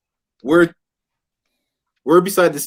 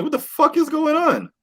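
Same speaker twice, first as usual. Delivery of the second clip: high disbelief; 13 semitones higher than usual. Retold or imitated. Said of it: "I'm like".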